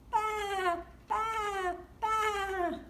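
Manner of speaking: high bleating sheep. Acted. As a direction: baa like a sheep. Sheep sound for kids.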